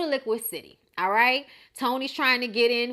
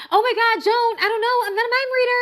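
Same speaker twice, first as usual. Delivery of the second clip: much higher and louder mock shrieking; mocking frantic voice; 11 semitones higher, 7 dB louder, faster than usual. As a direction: throw her squeal right back at her. [name] mocks Joan's frantic voice.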